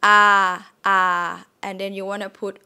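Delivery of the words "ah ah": open, sustained "Ah"; vowel "Ah". Shown as a demonstration. Teacher models the first half of the vowel, open "Ah". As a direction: say "Ah" loud and held. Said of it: "should be able to do ah".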